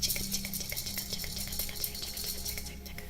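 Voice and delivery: soft, rhythmic whispered "chicka"; whispered chicka mouth sounds. Real, not acted. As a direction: repeat chicka softly like a ticking trigger. Repeated mouth sounds to the stream audience.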